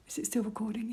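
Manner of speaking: "Is it still recording?" whispered softly. Real, not acted. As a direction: whisper the question to check. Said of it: "I'll bring this down a bit".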